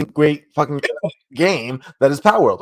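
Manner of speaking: normal talking voice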